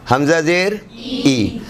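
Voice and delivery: hamza zer as a long, slow "ای" with an ee sound; pure long i. Shown as a demonstration. Quran teacher demonstrates hamza with zer, pure long ee. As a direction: draw "ای" out as ee, never ay. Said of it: "حمزہ زیر اے کبھی بھی نہیں ہوگا".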